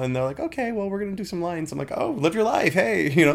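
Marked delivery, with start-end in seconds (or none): 0.4-1.7 much higher, breezy and offhand; casual crackhead voice. Retold or imitated. Crackhead roommate answering about the coke, breezy and offhand. 2.0-3.1 higher, sarcastic easygoing shrug; mock breezy reply. Replying to the roommates doing coke, sarcastically breezy.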